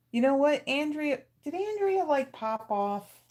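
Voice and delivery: sudden high, bright-eyed excitement; excited surprise. Real, not acted. Host on stream suddenly recalls viewer Andrea, excited. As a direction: pitch shoots up as she remembers a name.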